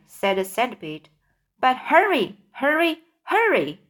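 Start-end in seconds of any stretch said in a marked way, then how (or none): 1.6-3.8 higher-pitched urgent repetitions; urging. A narrator's reading. Centipede rushing James to speak, urging. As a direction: press him harder with each word.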